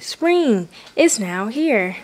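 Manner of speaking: usual speaking voice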